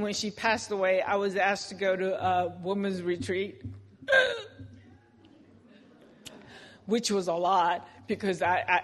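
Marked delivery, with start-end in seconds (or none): 4.0-4.7 short bright laugh bursting out high; high burst of laughter. Real, not acted. Laughs at the memory.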